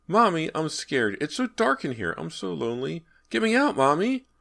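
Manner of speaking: put-on high, frightened child's voice; a lonely boy begging to be let out; scared little boy. A narrator's reading.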